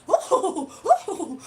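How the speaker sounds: loud laughing whoa, pitch climbing; goofy laugh. Retold or imitated. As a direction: imitate a class clown's goofy laugh. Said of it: "That's how he used to laugh".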